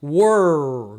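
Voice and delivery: "Were" with a long retroflex R; pulled R. Shown as a demonstration. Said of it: "como que você pronuncia?".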